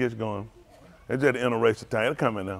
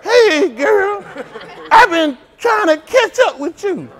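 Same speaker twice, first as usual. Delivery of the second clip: high, laughing put-on pickup voice; mock smooth talker; 14 semitones higher, 7 dB louder, slower than usual. Mocking a boy chasing a girl, high laughing. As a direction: ham up a clumsy pickup line.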